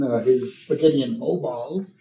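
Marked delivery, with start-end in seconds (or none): none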